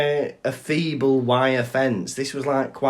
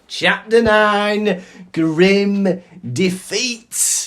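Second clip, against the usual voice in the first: slow, loud, higher title announcement; announcing title; 8 semitones higher, 6 dB louder, much slower than usual. Acted. Host introducing the next chapter to his co-host, slow announcement. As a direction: announce it like a grand heading.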